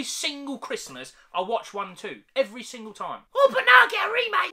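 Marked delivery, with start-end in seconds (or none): 3.3-4.5 high, loud, put-on voice of fake glee; mock the news of a remake; sarcastic mock outburst. Mocking Disney's remake, high and loud put-on voice.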